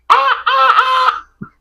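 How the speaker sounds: high yelping cries with a laugh; imitated dog yelps. Copying the dog whose tail got shut in the door, high laughing yelps. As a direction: yelp like a hurt dog, half laughing. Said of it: "he's like".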